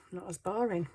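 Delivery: normal talking voice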